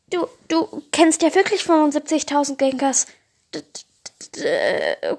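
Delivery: high, loud frightened stammering; a hint of panic, stumbling over the words; scared stammer. Glumandas frightened by the Gengars, high stammering apology.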